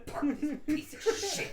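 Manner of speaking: laughing Chucky quote. Retold or imitated. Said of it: "They're so aggressive".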